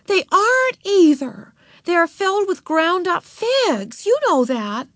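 put-on high, indignant child's contradiction; high-pitched scandalized big sister; shocked protest. A narrator's reading. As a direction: set her straight, loud and high. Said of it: "Beezus was shocked".

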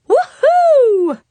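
high, loud woohoo; cheer. Acted. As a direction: whoop it out in mock celebration. Cheering at sister's hygiene.

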